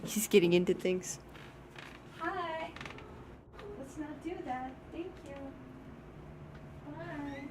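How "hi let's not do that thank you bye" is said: soft to cat. Real, not acted. Shooing her cat out, soft high baby talk. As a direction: coax the cat out gently and softly.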